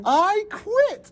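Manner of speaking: high-pitched dramatic quitting; mock outburst. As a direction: announce it high, imitating a fed-up employee. Acted quote of quitting after date.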